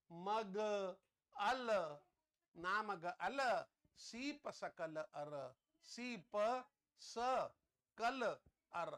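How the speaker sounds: ना मग अल, सी प स कल अर said slowly in separate syllables; spelling out the code. Shown as a demonstration. Teacher sounding out the second memory code. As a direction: break ना मग अल सी प स कल अर into slow syllables. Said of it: "spelling के हिसाब से".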